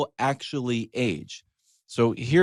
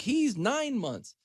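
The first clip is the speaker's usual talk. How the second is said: much higher and slow, doting parent; mock proud parent; 11 semitones higher, 8 dB quieter, slower than usual. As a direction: coo it like a proud new parent.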